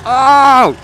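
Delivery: high, loud gasping oh; a big shocked crowd oh. Retold or imitated. Crowd reacting as he dangles, loud shocked gasp. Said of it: "everyone was like".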